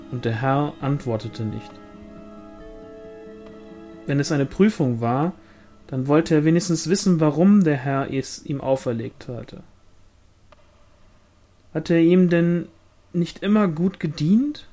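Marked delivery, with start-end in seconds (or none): none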